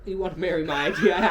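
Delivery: cracking up, loud and fast; real laughing. Real, not acted. Botching the elf father's line, cracking up. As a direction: lose it laughing mid-question.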